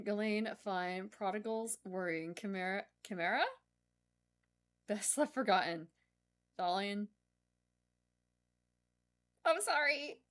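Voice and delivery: ordinary talk, nothing marked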